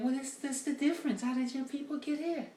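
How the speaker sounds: high put-on woman's voice; woman explaining. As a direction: lecture him in a high mock lady voice. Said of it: "She said".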